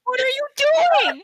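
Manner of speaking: high, loud, slow incredulous demand; incredulous yell. Acted. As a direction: drag out the question in disbelief. Confronting the shark-person.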